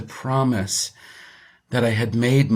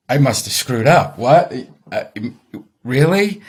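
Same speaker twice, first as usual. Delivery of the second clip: alarmed disbelief; 2 semitones higher, 5 dB louder than usual. Retold or imitated. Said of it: "And I was like".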